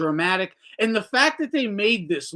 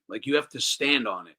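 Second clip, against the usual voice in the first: ordinary talk, nothing marked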